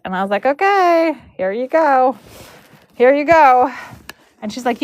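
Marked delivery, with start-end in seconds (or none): 0.4-3.7 high, lilting mock-cheerful handover; retold obliging okay. Braider handing over to the barn manager, high obliging lilt. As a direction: sing it out lightly, pitched well up.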